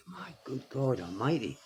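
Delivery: very soft, appalled inner remark; hushed disbelief under the breath; thought to self. Retold or imitated. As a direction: breathe it privately, as a silent reaction. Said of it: "I think".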